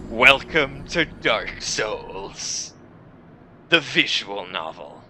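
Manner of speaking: slow, drawn-out dramatic announcer; title announcement. Acted. Host introduces the game to viewers, slow grand announcement.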